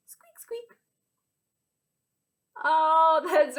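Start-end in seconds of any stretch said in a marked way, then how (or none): 0.1-0.8 tiny, high, hushed squeak; mouse squeak. Pretend. Teacher voicing a mouse for children, tiny high squeaks. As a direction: squeak softly like a little mouse. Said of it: "likes to say".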